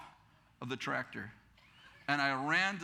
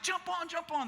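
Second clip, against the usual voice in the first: mock-frantic high call; urgent calling; 12 semitones higher than usual. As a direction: hurry them aboard in a high voice. Calls girls onto the truck.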